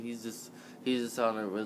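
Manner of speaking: usual speaking voice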